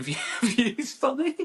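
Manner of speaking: breaking into a high laugh. Real, not acted. Creator laughing about a video's views, high amused laugh. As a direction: laugh through the words. Laughing at own view count.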